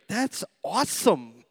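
mock amazed. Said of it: "you're like".

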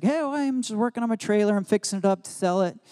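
high, fast imitation of a cheerful old man under his trailer; light impression of an old Southern grandpa, high and quick. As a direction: toss it off like a busy old grandpa. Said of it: "He's like".

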